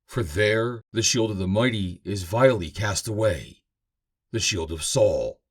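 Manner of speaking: ordinary talk, nothing marked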